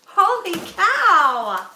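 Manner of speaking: slow, loud amazed exclamation; surprised. Real, not acted. Reacting to a huge donation, amazed. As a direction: stretch it out in disbelief.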